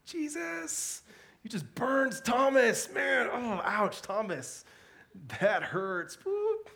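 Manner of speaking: hammed-up schoolyard ooh-you-got-burned; slow, high, loud mock taunt. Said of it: "I used to read that and be like".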